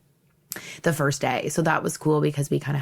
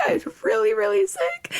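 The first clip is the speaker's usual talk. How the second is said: high, whining plea, stretched out; mock sick whine. Retelling being locked out of her hotel room sick, high whine. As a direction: pitch it way up like a sick kid whining. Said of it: "and I was just like".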